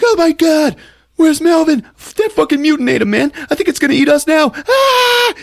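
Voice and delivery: shrill-high, loud terrified yelling, hammed up; panicked screaming. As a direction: scream as if about to be eaten, mock terror. Acted panic at monster bug.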